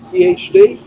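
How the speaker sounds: high, loud mock question; imitating the mailman. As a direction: pitch the mailman's guess up and loud.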